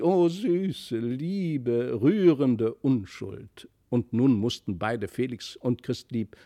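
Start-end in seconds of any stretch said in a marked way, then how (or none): 0.0-3.7 lightly voiced grand lady gushing; gushing exclamation. A narrator's reading. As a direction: swoon over the child like a sentimental countess. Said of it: "Da rief sie".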